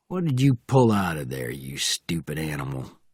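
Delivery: Southern driver's voice, falling away low at the end; Southern accent. A narrator's reading. Driver scolding his dog, in a Southern accent. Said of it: "very southern voice".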